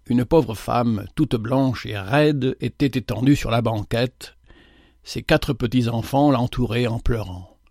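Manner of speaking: usual speaking voice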